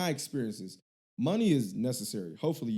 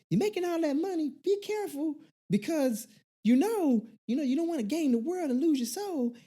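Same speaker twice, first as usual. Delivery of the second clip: very high, preachy auntie warning. Retold or imitated. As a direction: lecture him like a nosy aunt. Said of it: "this is my auntie voice".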